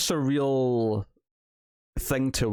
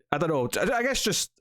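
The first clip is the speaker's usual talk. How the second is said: usual speaking voice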